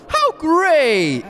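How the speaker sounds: high mock cheer; hammed-up delight; imitating jubilant founder. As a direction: exclaim it high, like a celebration. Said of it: "he would tell them".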